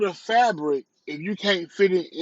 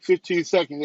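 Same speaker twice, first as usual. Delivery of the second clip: ordinary delivery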